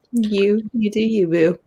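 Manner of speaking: usual speaking voice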